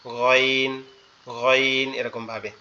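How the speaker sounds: গইন said from the back of the throat, gargled; Arabic letter ghain. Shown as a demonstration. Teacher demonstrates the letter গইন. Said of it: "এখান থেকে উচ্চারণ হবে".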